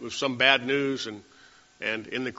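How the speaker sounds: ordinary talk, nothing marked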